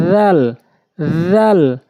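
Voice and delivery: রাল with tongue tip on tooth tips, loud and clear; রাল pronounced as in Quran recitation; Arabic letter demo. Shown as a demonstration. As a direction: sound out রাল slowly for learners. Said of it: "জিহ্বার আগা, দাঁতের আগা।".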